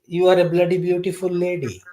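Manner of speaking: put-on a light, playful Indian accent. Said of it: "let me tell you in my Indian accent".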